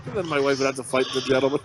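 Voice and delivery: ordinary talk, nothing marked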